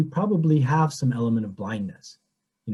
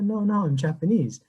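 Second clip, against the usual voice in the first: higher-pitched retold protest; mildly shocked; 8 semitones higher than usual. Japanese parishioner correcting a Greek woman, mildly shocked imitation. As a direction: imitate someone taken aback, pitched up. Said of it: "she was kind of shocked".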